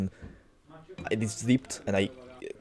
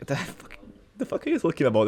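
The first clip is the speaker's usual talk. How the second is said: incredulous outburst; 7 semitones higher, faster than usual. Retold or imitated. Outraged at mother's claim.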